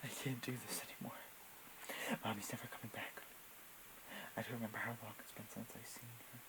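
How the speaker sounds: very hushed, small voice; almost whisper it like a lost child; tiny, fragile murmur; soft-spoken, childlike. Acted. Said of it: "soft-spoken and childlike".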